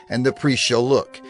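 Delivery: ordinary delivery, nothing marked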